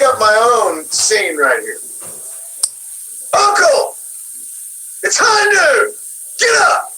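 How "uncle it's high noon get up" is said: much higher, called-out cowboy line; John's high noon line. Pretend. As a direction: wake the old man up with a cowboy call. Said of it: "do your best high noon voice line from John".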